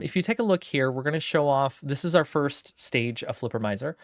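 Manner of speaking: normal talking voice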